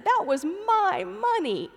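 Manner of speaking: put-on high, slow child's sobbing protest; sobbing toddler. Toddler insisting to his father about the quarter, high slow sobbing. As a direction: plead it through tears like a little boy. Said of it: "he said".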